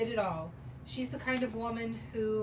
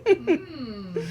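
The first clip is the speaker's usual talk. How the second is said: short amused chuckle into a hum; amused laugh; 10 dB louder than usual. Acted. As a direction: laugh it off lightly. Laughs at the pigeon joke.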